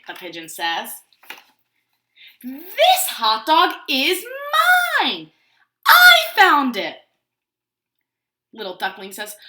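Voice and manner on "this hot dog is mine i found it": slow, loud grabby claim that ends in a yell; possessive shout. Acted. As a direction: bellow that the hot dog belongs to him. Pigeon voiced, possessive.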